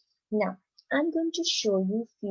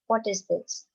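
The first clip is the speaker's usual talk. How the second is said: ordinary talk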